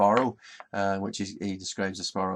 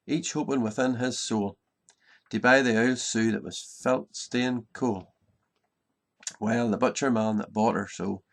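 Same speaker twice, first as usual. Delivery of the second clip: lively Ulster Scots verse read aloud, slightly higher and louder; poem recitation; 3 semitones higher, 4 dB louder than usual. Acted. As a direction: tell the funny rhyme to the audience with relish.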